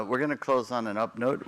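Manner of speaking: ordinary delivery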